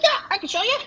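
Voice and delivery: very high goblin voice; high-pitched, obliging goblin guide; eager goblin. Acted. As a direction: agree as a helpful little goblin. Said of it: "He's like".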